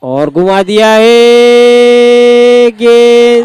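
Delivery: stretched-out excited boundary call; excited cricket commentary. Real, not acted. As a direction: draw out the big hit call.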